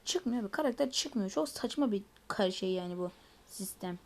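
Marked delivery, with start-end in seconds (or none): none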